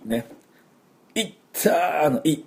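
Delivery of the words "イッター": higher ow after banging a knee; pained yelp. Retold or imitated. Teacher imitating a yelp after hitting a knee. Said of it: "膝とかぶつけて".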